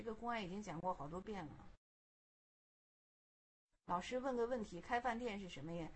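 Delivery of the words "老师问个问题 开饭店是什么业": plain reading voice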